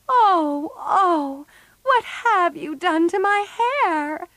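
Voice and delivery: high-pitched wail of dismay; horrified cry. A narrator's reading. Meg sees her burnt hair come off, horrified. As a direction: cry out in horror, pitch shooting up. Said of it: "cried Meg".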